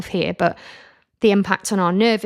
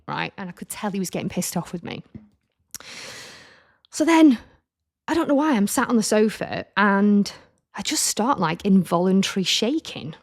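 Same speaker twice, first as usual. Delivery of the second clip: ordinary delivery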